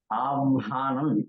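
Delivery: slow "avhanam" with the v before the h; drawn-out "avhanam", v first, then h; correct "avhanam". Shown as a demonstration. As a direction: say "avhanam" slowly as av-ha-nam. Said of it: "It is avhanam".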